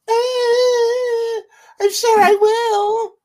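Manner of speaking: very high, loud drawn-out squealing impression; Stella. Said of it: "That's your Stella impression?".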